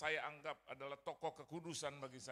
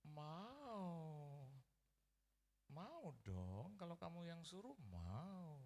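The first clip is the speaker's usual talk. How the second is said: fond, coy agreement; 3 semitones higher, much slower than usual. Retold or imitated. Sick mother giving in to favourite son, fondly. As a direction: agree sweetly with a long glide.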